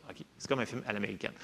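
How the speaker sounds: ordinary talk, nothing marked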